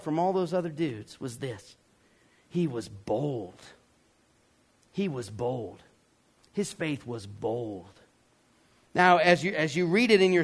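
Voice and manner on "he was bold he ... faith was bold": low, slow and softer; hushed emphasis. Real, not acted. Preacher naming what set Bartimaeus apart, hushed and weighty. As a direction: drop the voice and let each sentence land slowly.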